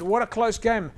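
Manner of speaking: normal talking voice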